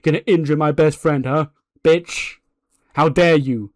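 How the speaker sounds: mock-furious shout; acted angry shouting. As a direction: lay into them as an aggrieved friend. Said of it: "She's, like, shouting at her".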